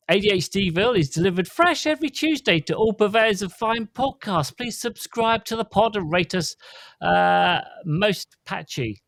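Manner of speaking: bright podcast outro announcer voice; announcer read. Acted. Host reading the podcast outro to listeners, announcer voice. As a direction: sell the outro like a radio announcer.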